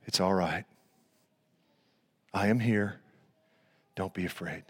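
low, hushed, soothing reassurance; gentle carpenter voice. Acted. Jesus calming disciples in the storm, low and soft. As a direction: soothe them softly, pausing between phrases. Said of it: "Beautiful carpenter voice".

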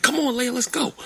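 high call to the dog, come on. Retold or imitated. Calling the dog at night.

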